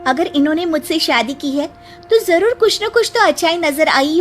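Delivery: pointed daughter-in-law retort; indignant young bride. Acted. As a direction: answer the gossips back with polite indignation. Bride defends mother-in-law to gossips.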